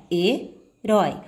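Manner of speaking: herói slowly split as he, pause, rói; he... rói, with a clear break between; split into syllables. Shown as a demonstration. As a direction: separate herói into its two syllables. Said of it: "Quando você for separar a palavra herói".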